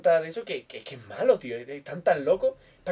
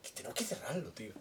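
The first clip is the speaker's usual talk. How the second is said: mock whisper; 5 semitones lower, 18 dB quieter than usual. Mock conspiratorial punchline.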